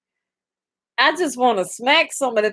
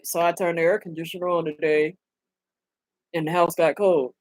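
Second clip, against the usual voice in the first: mock deadpan comedian; 5 semitones lower, 5 dB quieter than usual. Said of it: "That's the whole fucking joke".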